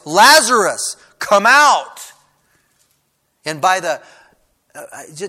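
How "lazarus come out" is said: big, high shout, rising and falling; loud commanding call. Acted. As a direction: call him out with full force. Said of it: "called out in a loud voice".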